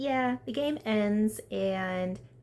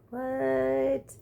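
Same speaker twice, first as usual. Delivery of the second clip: higher, louder incredulous what; shocked what; 6 semitones higher, 4 dB louder than usual. Retold or imitated. Vlogger reacting to George suggesting the hideaway, shocked. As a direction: react like you can't believe it.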